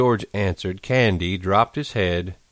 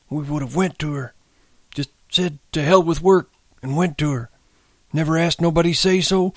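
put-on high, wistful old man's chant; tearful sing-song; 10 semitones higher than usual. A narrator's reading. Candy repeats the old words about their place, sing-song. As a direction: recite the old dream phrase by phrase, very high. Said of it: "went on in a sing-song".

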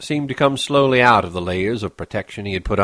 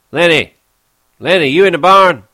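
loud, higher call from afar; 8 semitones higher, 11 dB louder than usual. Acted. George calls for Lenny in the barn, calling out. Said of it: "a voice called".

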